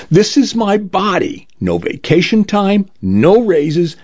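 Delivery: high-pitched, emphatic indignation; indignant refusal. A narrator's reading. Client rejecting the nanobots' demands to the lawyer, indignant.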